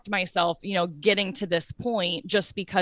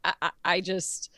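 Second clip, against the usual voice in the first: normal talking voice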